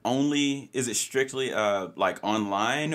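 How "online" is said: ordinary talk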